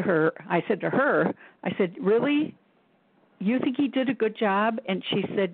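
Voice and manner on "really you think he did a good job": higher-pitched, questioning retold disbelief; mock disbelief. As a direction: question it, pitch lifted in doubt. Retold disbelief at the angel's praise.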